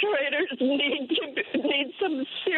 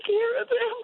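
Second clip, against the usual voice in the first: real crying; 9 semitones higher, slower than usual. Real, not acted. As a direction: voice breaking into tears, pitched up. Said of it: "I'm sorry to be so destroyed".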